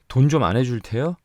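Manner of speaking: sudden high, loud yell; husband shouts at his silent wife. A narrator's reading. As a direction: bark the demand at her. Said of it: "소리를 빽 질렀다".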